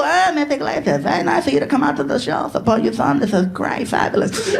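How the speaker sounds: mock showbiz small talk, trailing down; Jay Leno. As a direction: schmooze a guest's mother like a TV host. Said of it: "He was so cool".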